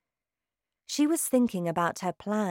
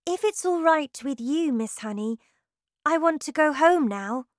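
put-on young girl; 8 semitones higher, 3 dB louder than usual. A narrator's reading. Girl character voiced by narrator.

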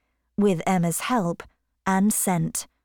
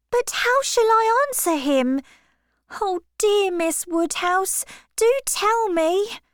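put-on high, girlish and breathless with news; a young girl bursting with a proposal; flustered young Harriet; 13 semitones higher than usual. Harriet telling Miss Woodhouse about the proposal, high flustered girl.

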